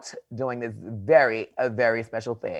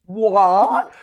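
loud, higher mock British "what"; overdone British outburst; terrible English accent; 5 semitones higher, 6 dB louder than usual. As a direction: blurt it in a bad English accent. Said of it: "in a terrible English accent".

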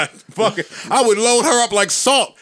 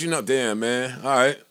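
mock resigned sigh; 7 semitones lower, 6 dB quieter than usual.

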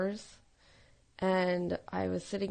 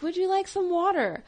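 higher, polite flight attendant voice; stewardess; 9 semitones higher than usual. Retold or imitated. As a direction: offer the water like a sweet stewardess. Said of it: "the stewardess would come up and be like".

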